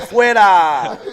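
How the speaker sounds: loud, drawn-out heckle; mock booing crowd. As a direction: jeer like an audience rejecting an act. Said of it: "they would have booed you off the stage".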